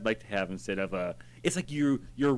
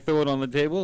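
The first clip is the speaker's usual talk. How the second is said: ordinary talk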